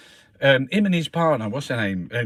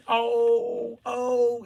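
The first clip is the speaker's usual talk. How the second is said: mock crying; 9 semitones higher than usual. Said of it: "he's crying".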